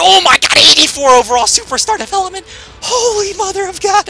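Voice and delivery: high, loud ecstatic shouting; screaming with joy at the draft. Real, not acted. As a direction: lose it over an amazing rookie. Amazed by an 84 rookie.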